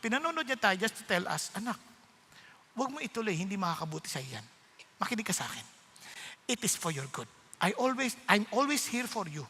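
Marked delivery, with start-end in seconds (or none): 1.5-9.4 soft, low, tender advice; gentle fatherly counsel. Retold or imitated. God telling his child not to go on, gentle. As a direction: counsel a child softly and reassuringly.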